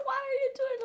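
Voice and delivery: high, loud mock-scolding mom; retold high-pitched alarm. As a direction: imitate a horrified mother crying out. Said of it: "my mom was like".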